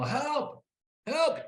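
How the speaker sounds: high-pitched mock cries for help; acted yell. Game master as Mary and Cherry calling for help, high mock yell. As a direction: cry out high, holding the volume back. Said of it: "are both gonna yell".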